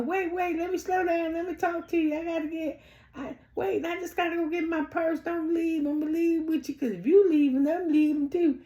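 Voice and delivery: very high, pleading imitation; mock frantic begging in a squeaky-high monotone; mimicking Nell. Acting out Nell chasing Destiny, pleading.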